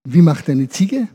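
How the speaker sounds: ordinary delivery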